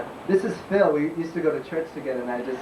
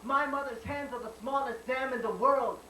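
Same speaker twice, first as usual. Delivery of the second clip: spoken-word poem; 11 semitones higher than usual. Acted. Poet tells America of a mother's hands, declaimed spoken-word poem. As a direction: slam poet's raised-pitch declaiming.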